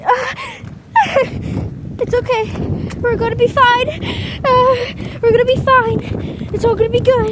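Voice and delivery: high loud frantic reassurance; shouting over wind. Real, not acted. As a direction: yell comfort into the gale.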